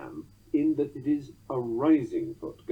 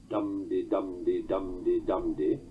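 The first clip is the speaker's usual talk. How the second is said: "dum-de-dum-de-dum-de-dum-de" chanted slowly, stress on each DUM; even slow DUM-de beat, ending on a weak "de"; trochaic beat; 5 semitones lower, slower than usual. Shown as a demonstration. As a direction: tap out "dum-de" four times, falling strong to weak. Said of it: "one in pure trochees, a la Hiawatha".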